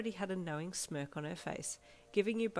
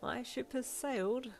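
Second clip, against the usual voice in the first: proud; 8 semitones higher, slower than usual. A narrator's reading. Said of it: "Missus Craig said proudly".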